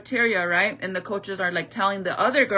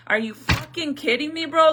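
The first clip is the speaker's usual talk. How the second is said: incredulous, higher-pitched mock outburst; retold outrage; 6 semitones higher, 3 dB louder than usual. Mother recounting her anger at the coaches, indignant and incredulous. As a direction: replay being mad, pitch jumping up in disbelief. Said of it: "I was so fucking mad".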